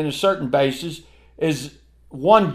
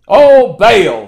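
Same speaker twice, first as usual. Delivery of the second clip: loud mock wail; mock crying out; 4 semitones higher, 10 dB louder than usual. Preacher mimicking idol worshippers, loud mock cry. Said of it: "when I hear those cry out".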